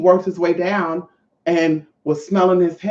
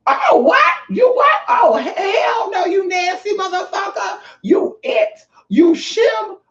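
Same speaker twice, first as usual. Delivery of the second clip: very high-pitched mock shriek of outrage; outraged outburst; 14 semitones higher, slower than usual. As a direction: draw out the insults in hammed-up shock. Acting out outraged reaction to disclosure.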